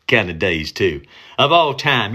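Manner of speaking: usual speaking voice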